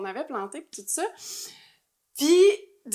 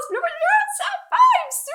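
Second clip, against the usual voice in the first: laughing; 4 dB louder than usual. Real, not acted. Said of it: "laughing retelling".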